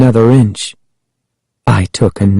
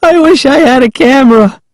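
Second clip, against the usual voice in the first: put-on high, quick gleeful girl's voice; gleeful exclamation; 14 semitones higher, 3 dB louder, faster than usual. A narrator's reading. Prankster teasing her scared cousin, high gleeful exclamation. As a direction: crow over the prank in a high voice. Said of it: "she exclaimed when she finally stopped laughing".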